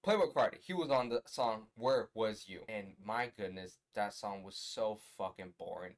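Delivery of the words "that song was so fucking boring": normal talking voice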